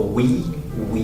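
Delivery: We with rounded lips. Shown as a demonstration. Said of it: "make sure you round your lips and go".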